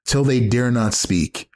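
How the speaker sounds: normal talking voice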